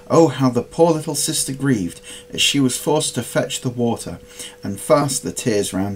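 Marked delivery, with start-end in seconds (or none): none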